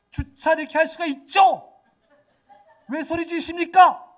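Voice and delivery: loud, high-pitched indignant shouting; angry retort. Retold or imitated. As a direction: fire back in acted outrage. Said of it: "너무 열이 받는 거예요". (열받아서 크고 높게 맞받아치는 고함)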